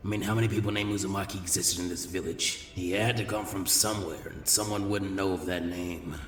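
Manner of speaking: put-on gentle, low old village elder; old Hokage. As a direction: speak like a patient elderly leader. Hiruzen inner thought, lower.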